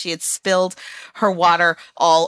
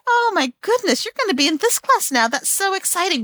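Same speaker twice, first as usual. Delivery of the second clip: much higher, bright exaggerated enthusiasm for a small child; upbeat cheerful reframe; 11 semitones higher than usual. Shown as a demonstration. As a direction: sell the class change as a thrill. Cheering up the upset daughter.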